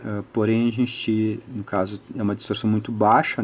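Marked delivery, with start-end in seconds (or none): none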